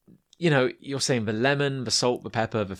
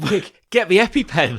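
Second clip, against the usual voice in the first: mock panic; 6 semitones higher, 7 dB louder than usual. Mock allergy emergency.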